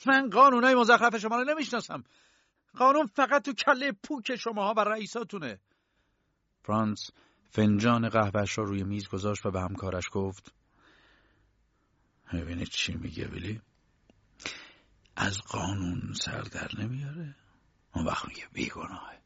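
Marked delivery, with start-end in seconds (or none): 0.1-5.6 high-pitched, loud contempt; furious, scornful yelling far up in pitch; angry shout. Acted. K's acted quarrel with guards. 12.3-19.2 low, quiet aside to a colleague; hushed, lowered voice of a dismissive guard; scoffing guard. Acted. Franz sneers to his colleague.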